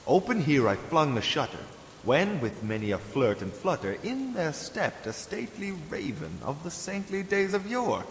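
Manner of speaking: plain reading voice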